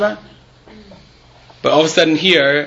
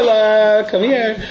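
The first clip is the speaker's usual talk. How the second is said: mother calling; 5 semitones higher, much slower than usual. Retold or imitated. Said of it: "His mother didn't call him Kli Yakar".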